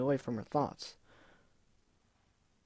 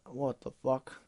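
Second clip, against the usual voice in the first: puzzled aside; 4 dB quieter, slower than usual. Real, not acted. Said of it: "That is a weird sentence".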